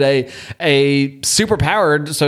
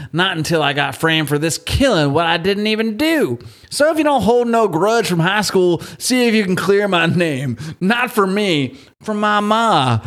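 higher Southern voice, pleading tone; Deep South accent; 4 semitones higher than usual. Acted. Host finishing the dead convict's letter in a Deep South accent. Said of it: "he does talk like he's from, like, the Deep South".

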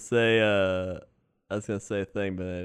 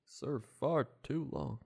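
slow, halting, softer Obama attempt; half-assed Obama; 4 semitones higher, 8 dB quieter, much slower than usual. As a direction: loosely imitate Obama with measured pauses. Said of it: "half-assed Obama".

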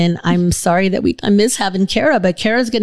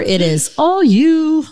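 dramatic handoff; 9 semitones higher, much slower than usual. Acted. Handing over the show dramatically.

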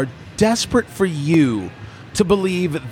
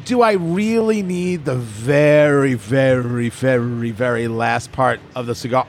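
slow, each very stretched and sinking lower; drawn-out very; 4 semitones lower than usual. Real, not acted. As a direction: lean on very, very, very. Drags out very for emphasis.

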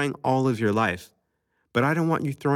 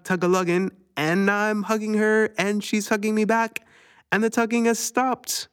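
high, bouncy pretend alarm for a child; playful hammed-up alarm, then hugging; mock panic; 8 semitones higher than usual. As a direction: ham up the fright to make her giggle. Mock panic playing with little girl.

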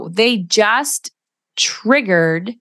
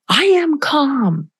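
indignant retort; 9 semitones higher, much slower than usual. Retold or imitated. Upset person insists they're calm.

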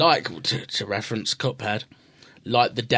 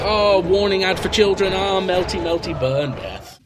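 mock warning ad; 7 semitones higher, 3 dB louder than usual.

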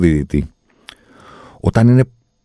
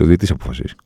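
usual speaking voice

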